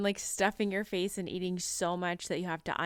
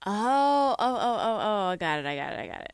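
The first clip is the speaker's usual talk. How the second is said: laughing realisation; 4 dB louder than usual. Real, not acted. Realising the meaning.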